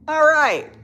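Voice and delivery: high, loud all right; giddy. Real, not acted. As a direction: burst out still giggling. Recovering after laughing.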